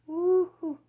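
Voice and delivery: high, loud whoop; giddy woo-hoo; playful whoop. Real, not acted. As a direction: let out a silly playful cheer. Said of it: "I'm acting like I'm playing with my granddaughter or drunk".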